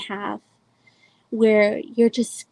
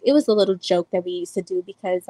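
usual speaking voice

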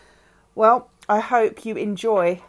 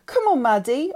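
loud, higher urging; 7 semitones higher, 7 dB louder than usual. A narrator's reading. Lauren urges Maddie towards the door, loud and urgent. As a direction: hurry her along, pressing loudly. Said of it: "I urged".

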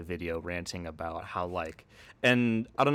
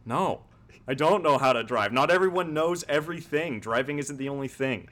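louder, higher mock-defensive protest; indignant comeback; 5 semitones higher, 3 dB louder than usual. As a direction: act out a sketch character's emphatic retort. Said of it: "And Tim's character responds".

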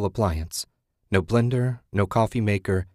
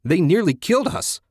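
louder, high-pitched protest; indignant shout; 10 semitones higher, 5 dB louder than usual. A narrator's reading. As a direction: shout it back in disbelief. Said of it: "Ted shouted".

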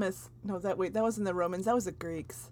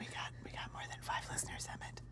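whisper; 19 dB quieter, faster than usual. Real, not acted. Whispered reply.

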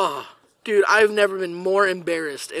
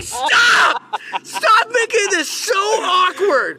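high, loud, drawn-out retold protest; exasperated yelling; 12 semitones higher, 4 dB louder, much slower than usual. Student yelling at classmate to stop, exasperated. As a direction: shout it high, acting out desperate embarrassment.

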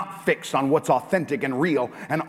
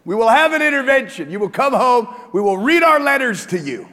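loud, raised mock scolding with a laugh in it; hammed-up intervention threat; retold angry rant; 11 semitones higher, 9 dB louder than usual. Pastor acting out scolding his wife, loud mock fury. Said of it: "I said".

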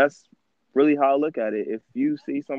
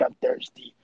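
whispered plea; 4 dB quieter, slower than usual. Retold or imitated. Homeless man begs, whispered.

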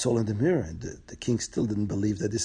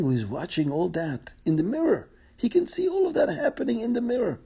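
ordinary delivery, nothing marked

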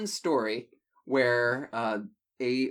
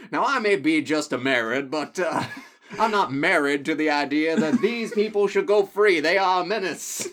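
country lawyer voice; 4 semitones higher, 7 dB louder than usual. Acted. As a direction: play a simple country lawyer making his case.

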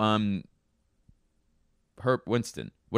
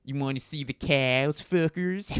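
raised, exaggerated Midwestern vowels; Midwestern accent; 8 semitones higher than usual. Shown as a demonstration. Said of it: "that's my Midwestern accent".

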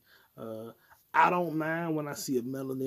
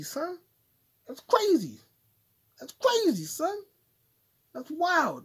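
high hype voice; 13 semitones higher, 5 dB louder than usual. Acted. Held high put-on voice.

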